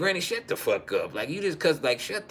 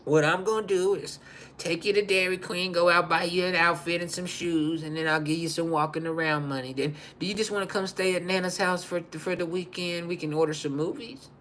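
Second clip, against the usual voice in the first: a touch higher, soft nana; a hint of a sweet nana, softer; lightly voiced nana; 4 semitones higher, 4 dB quieter than usual. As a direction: offer treats like a doting grandma. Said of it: "But she said".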